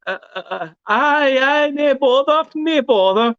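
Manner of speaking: high nervous squeak in a put-on Scottish accent; scared fake Scottish. Terrified fan in a Rangers pub faking a Scottish reply. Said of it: "I went".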